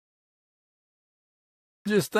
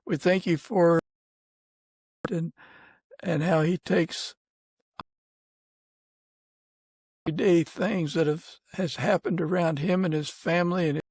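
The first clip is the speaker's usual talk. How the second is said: earnest, unhurried prayer voice; gentle closing prayer, reverent and steady. Real, not acted. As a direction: pray sincerely over the guest and his family.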